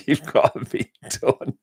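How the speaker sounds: amused, breaking into laughter; laughing. Real, not acted. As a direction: laugh through the repeated line. Repeats it while laughing.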